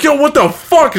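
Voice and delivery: high, loud disbelieving yelp; shocked. Retold or imitated. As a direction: blurt it out in shock. Outraged retold reaction to woman.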